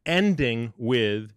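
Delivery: ordinary talk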